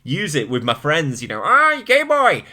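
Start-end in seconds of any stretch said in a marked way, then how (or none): 1.4-2.4 loud, high-pitched playground jeering; childish taunt. Retold or imitated. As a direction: call it out high and loud like a teasing kid. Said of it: "like when I'm a child".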